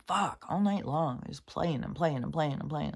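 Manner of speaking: usual speaking voice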